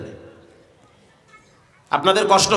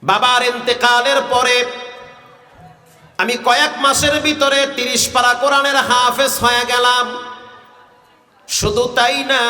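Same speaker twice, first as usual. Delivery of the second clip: sustained sing-song sermon narration; melodic waz preaching. Acted. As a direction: hold each phrase on a chanted note. Tuneful sermon storytelling. (সুরে সুরে ওয়াজ)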